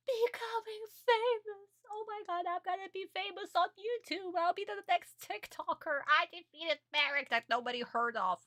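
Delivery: high-pitched fanboy squeal of excitement; hammed-up starstruck bragging; mock excited gushing. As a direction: gush in a very high, giddy voice. Mimics Jonouchi dreaming of fame.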